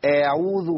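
أعوذ with its first vowel stretched long; added alif. Shown as a demonstration. Teacher points out the error, extra alif in أعوذ. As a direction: drag the opening a of أعوذ. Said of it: "ألف زائدة".

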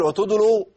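faulty وتدلوا as a forced wa-tudulu; overdone effort. Shown as a demonstration. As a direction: say وتدلوا as if charging into battle. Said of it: "تحس كده إن في واحد داخل".

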